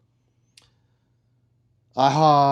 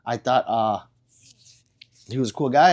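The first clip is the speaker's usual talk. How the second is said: ordinary talk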